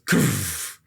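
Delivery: low, airy burst of noise; imitated gunshot. Podcaster retelling Ahab shooting himself, imitated gunshot. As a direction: make a gunshot sound with the mouth. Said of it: "and it goes".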